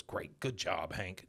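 quietly sarcastic praise; low, soft, irritated congratulations; pissed off. Retold or imitated. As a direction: mutter sarcastic praise at a husband. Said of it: "pissed off at Hank".

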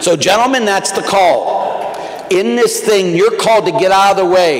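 loud, high, impassioned preaching; rousing sermon finale; preaching at full force. Acted. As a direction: build to a fervent climax, calling out. Sermon climax.

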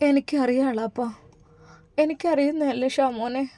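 hushed, heavy-hearted answer; quiet, sorrowful reply to uncle; soft sad reply. Acted. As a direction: say it softly, holding back tears. Heroine answers uncle sadly.

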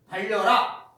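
loud, sharp order; command. Shown as a demonstration. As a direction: call out the command. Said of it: "뭐뭐 해라". (크게 외치는 명령)

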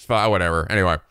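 ordinary talk, nothing marked

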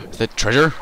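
gasping with greedy delight; thrilled to spot loot; excited. Real, not acted. Greedy gasp at spotted treasure.

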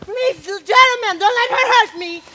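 high, pleading cry for help; high mock-frightened plea. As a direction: beg the men to shield you. Helena pleading for protection.

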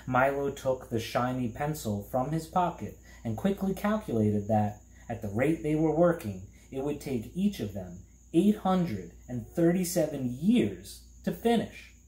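plain reading voice

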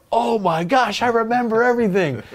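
hammed-up excited Canadian accent; excited quote. Retelling Clark's excited reply.

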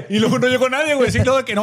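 can't believe nobody showed up; high, loud amazement; incredulous. Real, not acted. Laughing at the empty ring.

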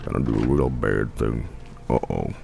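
ominous low voice. Acted. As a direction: rumble it like a villain narrator. Ominous commentary before bad deed.

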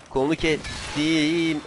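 slow, stretched "kestim"; drawn-out taunt. Pretend. Player gloating over a cut arm, slow drawn-out taunt. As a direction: drag out the vowels of "kestim".

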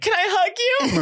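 squeaky high mock fan voice; a very high, girlish plea; imitating fan.

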